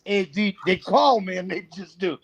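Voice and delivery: ordinary talk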